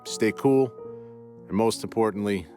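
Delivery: ordinary delivery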